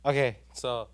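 ordinary talk

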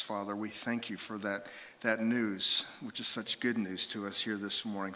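opening prayer. Acted. Pastor praying for the congregation, soft and reverent. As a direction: pray gently over the room.

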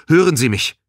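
high, loud check for contact; calling out. A narrator's reading. Balin checks the line, loud urgent call. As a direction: call into the communicator. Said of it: "rief Balin".

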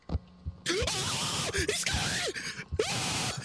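high panicked cry; frightened shriek. Real, not acted. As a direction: squeal it in real fright. Chased by monster in game.

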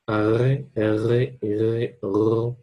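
long trilled r in "Arre, erre, irre"; rolled R. Shown as a demonstration. Teacher drills students on "Arre, erre, irre" with a long rolled R. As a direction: roll the r hard through "Arre, erre, irre, ro". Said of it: "roll your R".

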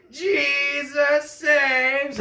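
slow high stretched shout; yelling back. Retold or imitated. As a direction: shout it back at him like the preacher. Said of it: "Fernando turns around and goes".

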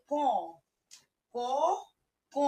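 each ক voiced through the nose; ক repeated with a nasalised vowel; nasal ক. Shown as a demonstration. Teacher repeats for pupils, nasal ক. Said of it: "নাক দিয়ে উচ্চারণটা হচ্ছে".

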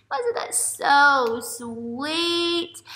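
very slow cooing question; gushing sweet. Real, not acted. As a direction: melt over the ending. Teacher gushing over ending.

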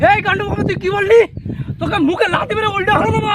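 acted outburst, rushing into a threat; outraged threat. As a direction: round angrily on the guru. Outraged husband threatens the guru.

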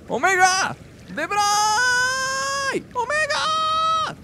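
long, high called names across a distance; calling out. Real, not acted. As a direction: call out to friends far away. Calling out for his friends.

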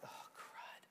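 barely audible whispered dread; imagined dismayed whisper. Retold or imitated. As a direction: whisper it as the brothers, sinking in dismay. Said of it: "You can imagine how they're like".

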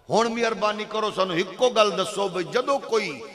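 usual speaking voice

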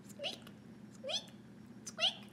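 thin high squeak, very soft; high squeaky noise. Acted. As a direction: squeak like a creaky floorboard. Imitating floor squeaks.

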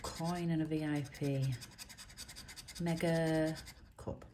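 ordinary talk

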